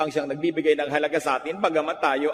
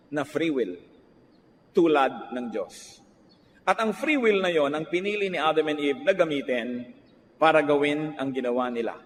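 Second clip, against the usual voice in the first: ordinary talk, nothing marked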